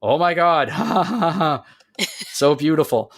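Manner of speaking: two heroes gushing over the sleeping captain, giggly; smitten gushing. Retold or imitated. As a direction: gush with a giggle over beauty. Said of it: "the two are just like".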